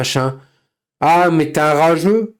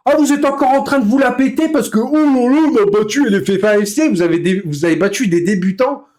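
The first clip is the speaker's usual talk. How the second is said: mock bragging; 9 semitones higher than usual. Mocking chat's bragging.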